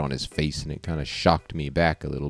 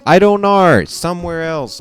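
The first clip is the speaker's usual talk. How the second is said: high, loud defensive reply; flustered outburst; 11 semitones higher, 8 dB louder than usual. Acted. Boy caught sneaking off, high loud outburst. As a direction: burst out high, then ease off.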